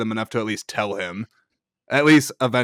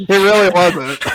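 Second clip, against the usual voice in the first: slow, loud and higher, laughing; self-mocking chuckle; laughing agreement; 8 semitones higher, 7 dB louder, slower than usual. Real, not acted. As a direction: laugh at your own dull point.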